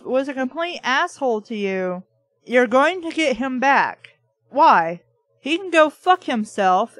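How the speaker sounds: ordinary delivery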